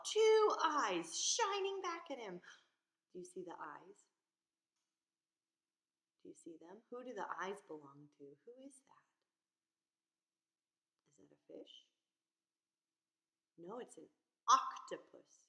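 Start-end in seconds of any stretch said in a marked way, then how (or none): 0.0-2.4 higher, louder, slow suspense; dramatic storyteller reveal; suspenseful reveal. A narrator's reading. Suspense in dark cave. 3.1-11.9 much softer, spooky hush; hushed questions. Real, not acted. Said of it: "hushed asking children".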